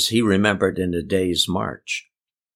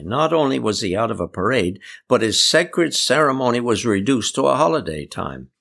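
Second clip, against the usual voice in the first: ordinary delivery, nothing marked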